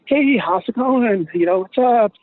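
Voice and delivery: high, drawn-out retold hello; cheery greeting.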